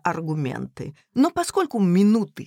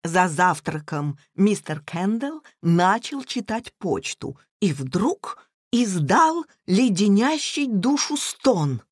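plain reading voice

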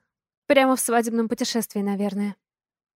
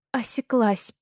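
ordinary delivery

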